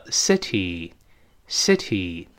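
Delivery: "city" as "seh-tee", with crisp t and final ee; correct short-i first vowel. Shown as a demonstration. Teacher correcting students, slow "city" as seh-tee. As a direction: slowly model "city", lax first vowel, long ee at the end. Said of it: "不对，叫city".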